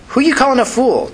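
high-pitched defensive challenge. A narrator's reading. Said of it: "Tina asked defensively".

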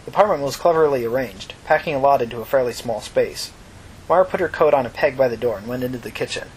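plain reading voice

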